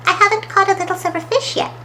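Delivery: high, quick, stubborn character voice. A narrator's reading. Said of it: "said Smudge stubbornly".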